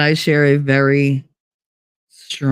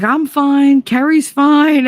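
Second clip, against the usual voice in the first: high, louder mimicked friend; mock reassurance; 11 semitones higher, 4 dB louder, slower than usual. The friend calling after a crash, high and insistent. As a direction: play the friend insisting he's fine.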